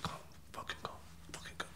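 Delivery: urgent secretive whisper; imitating a furtive, voiceless summons; whispered beckoning. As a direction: whisper it so the officers won't hear. Men beckoning unseen by officers.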